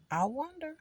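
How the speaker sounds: "wonder" lifted high then falling away; sing-song musing. Shown as a demonstration. Alone in the car, sing-song musing to herself. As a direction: float "wonder" up high and let it drop. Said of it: "repeats musing in varied tunes".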